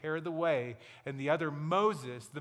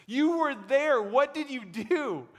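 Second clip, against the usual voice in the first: amazed; 10 semitones higher, 7 dB louder than usual. Retold or imitated. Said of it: "He's like".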